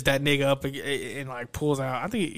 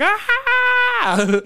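loud, very high falsetto shriek; piercingly high mock scream, held out; imitated scream; 7 dB louder than usual. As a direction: mimic a man screaming in pain. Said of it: "He's screaming like".

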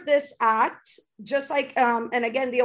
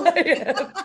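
laughing; 4 semitones higher than usual. Real, not acted. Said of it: "you're getting verklempt".

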